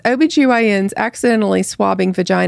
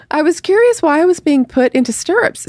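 higher-pitched, mock-puzzled patient; high quoted-patient voice; puzzled patient quote; 8 semitones higher than usual. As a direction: read it as a bewildered patient, pitched up.

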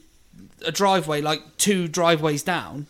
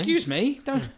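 raised pitch, offended disbelief; indignant excuse me; 6 semitones higher, 3 dB quieter than usual. Retold or imitated. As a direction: throw it back, affronted. Retold indignant retort to driver.

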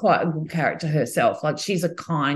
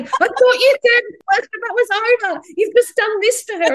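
imitating an indignant friend, much higher and louder; hammed-up outrage, much higher; mock angry friend; 5 dB louder than usual. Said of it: "another angry text from her saying".

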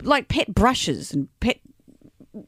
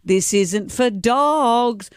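slow warning; much slower than usual. Retold or imitated. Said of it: "as I said the words".

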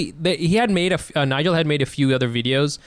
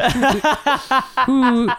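laughing mock suspicion; 8 semitones higher, much slower than usual.

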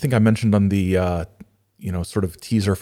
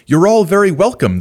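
merry, high and louder welcome; lightly sing-song storybook greeting, pitched up; 10 semitones higher, 7 dB louder, faster than usual. A narrator's reading. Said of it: "they sang merrily".

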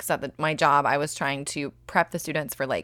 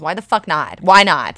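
exasperated; 8 dB louder than usual. Retold or imitated. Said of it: "I could think in my head is".

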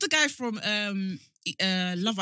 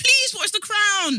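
high, slow, drawn-out plea; exasperated high-pitched begging; loud pleading; 12 semitones higher, 9 dB louder, much slower than usual. Real, not acted. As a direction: beg your friend loudly, stretching the words. Begging co-host to watch the show.

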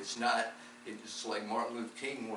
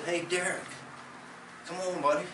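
friendly call; 6 semitones higher than usual. Retold or imitated. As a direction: beckon him cheerfully across the room. Calling young man to come along.

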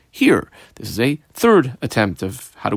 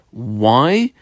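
ordinary delivery

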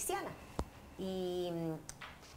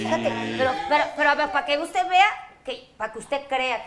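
loud announcing; 6 semitones higher, 10 dB louder than usual. Real, not acted. Announcing to the audience.

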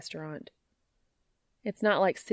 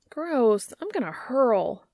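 high, loud disgusted whine; whining; 5 semitones higher, 6 dB louder, slower than usual. Acted. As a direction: groan in mock disgust. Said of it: "Joe whines".

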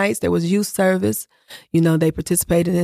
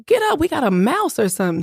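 urgent wake-up call; 7 semitones higher than usual. Retold or imitated. Urging husband out of bed.

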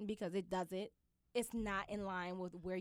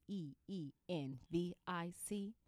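spelling it out; 8 semitones lower, 6 dB quieter, slower than usual. Shown as a demonstration. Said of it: "Let me spell that for you".

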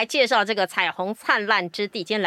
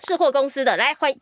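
ordinary talk, nothing marked

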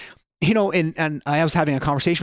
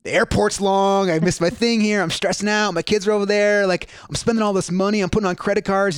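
higher-pitched, rattled list of complaints; stressed traveler; 5 semitones higher than usual. Retold or imitated. Traveler stressing out on vacation over money and kids, mock fretting. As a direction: imitate a frazzled holidaymaker venting.

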